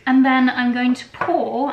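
normal talking voice

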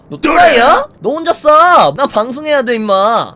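angry shout. Real, not acted. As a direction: snap back loudly at him. 친구에게 소리치며 싸움. (친구에게 화내며 크게 소리침)

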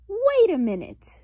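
high, loud wait a minute; sudden objection. A narrator's reading. Sister objecting to her brother's casting, high and loud. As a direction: stop them short, indignant.

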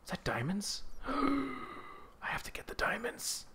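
gasp, then hushed and eager; excited whisper. Real, not acted. Spotting diamonds in the mine, excited whisper. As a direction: whisper like a treasure is right there.

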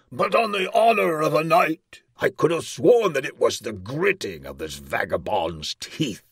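high, loud oath easing off; blustering king. A narrator's reading. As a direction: swear pompously as an accusing monarch. King accuses the dwarf.

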